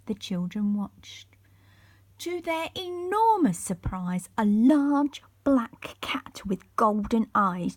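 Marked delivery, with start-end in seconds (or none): none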